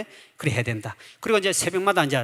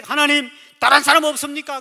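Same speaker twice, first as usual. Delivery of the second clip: aggrieved plea; 7 semitones higher, 5 dB louder than usual. Retold or imitated. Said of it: "아, 너무 억울해".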